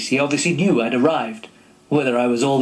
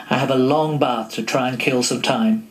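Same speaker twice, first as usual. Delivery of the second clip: plain reading voice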